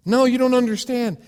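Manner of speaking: loud, high, frantic insistence; panicked protest. Pretend. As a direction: plead in a panic, cutting him off. Panicked man argues with God.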